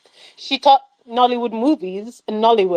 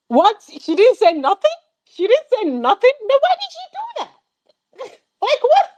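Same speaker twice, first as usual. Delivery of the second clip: much higher, incredulous questioning; disbelief; 13 semitones higher than usual. Real, not acted. Reviewer mocking the film's plot, incredulous and much higher.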